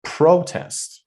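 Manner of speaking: "protest" stressed PRO-test, first syllable high. Shown as a demonstration. Teacher showing the other pronunciation, first syllable stressed. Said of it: "we can also pronounce it".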